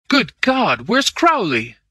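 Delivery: high, urgent exclamation; alarmed. A narrator's reading. Searching for Crowley after the shooting, alarmed. Alarm over a missing friend.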